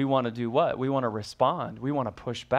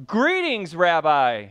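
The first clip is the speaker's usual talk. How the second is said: mock bright greeting; 3 semitones higher, 6 dB louder, slower than usual. Judas greets Jesus warmly.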